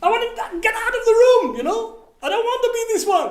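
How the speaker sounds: son's voice. Retold or imitated. Son on the phone says he hates his doubting self, high and loud. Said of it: "What do you feel?".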